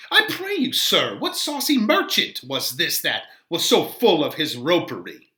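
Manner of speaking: put-on high, huffy old woman's voice; a fussy nurse demanding answers; voiced nurse, put out; indignant old nurse.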